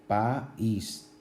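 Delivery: "país" broken into pa-ís with a gap; hiatus in two syllables. Shown as a demonstration. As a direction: separate the a and í of "país". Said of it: "se pronuncian en dos sílabas diferentes".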